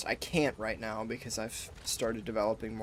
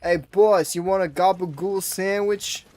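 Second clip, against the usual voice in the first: loud, higher-pitched New York guy with drawn-out words; New York accent; 4 semitones higher, 9 dB louder, slower than usual. Acted. Streamer mimicking one dude from New York, loud New York accent. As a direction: holler it like a brash New Yorker. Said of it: "one dude from New York".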